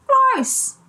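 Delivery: high squeaky gross; disgusted. Acted. Storyteller reacts to nose picking, high mock disgust. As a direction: pull a face and squeal it.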